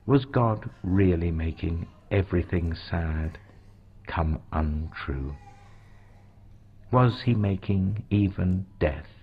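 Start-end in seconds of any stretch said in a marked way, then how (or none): none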